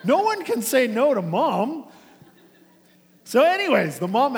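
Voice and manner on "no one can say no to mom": louder and higher, gleeful plotting with a laugh; scheming brothers. Retold or imitated. Brothers plotting to send mom, gleeful laughing voice. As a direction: pitch it up like kids hatching a plan. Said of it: "the two brothers, like".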